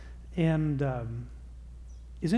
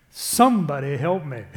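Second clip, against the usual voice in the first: laughing, louder plea for help; half-joking cry for backup; 7 dB louder than usual. Real, not acted. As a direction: beg the crowd through a laugh. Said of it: "pleading to the men, laughing".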